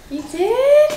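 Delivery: high delighted "you did?"; genuinely surprised, pitch jumps. Real, not acted. Surprised at gift.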